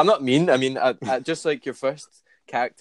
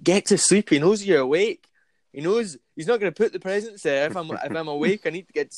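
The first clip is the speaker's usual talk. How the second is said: higher, urgent self-scolding; panicked inner voice; 7 semitones higher, 4 dB louder than usual. Retold or imitated. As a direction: hurry yourself off to sleep. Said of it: "in my head, being like".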